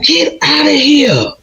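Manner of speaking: high, slow stunned disbelief. Retold or imitated. Seeing her birth card date, high stunned disbelief. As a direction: draw it out, can't believe it. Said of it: "I was like".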